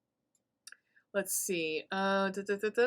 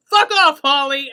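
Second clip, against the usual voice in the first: much higher, much louder snarling shout; rude yell; 6 semitones higher, 13 dB louder than usual. Retold or imitated. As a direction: bark it at the mom. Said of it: "He goes".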